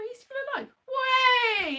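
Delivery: high squeal of delight; mock delight. Telling friend the actor lives, high mock squeal. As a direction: squeal it like a fan. Joyful reaction to actor alive.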